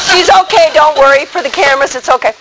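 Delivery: ordinary talk, nothing marked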